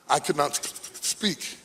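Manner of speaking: lightly acted stammer, catching on the s before "speak."; mock stutter. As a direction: breaks off mid-word, then "speak." comes out almost whispered.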